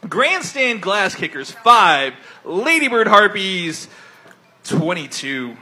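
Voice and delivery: score announcer. Acted.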